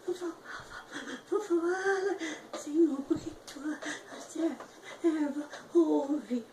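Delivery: a broken, breathy tearful whisper; breath of a voice. Retold or imitated. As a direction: choke the words out on breath, crying. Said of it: "saía só sopro de voz".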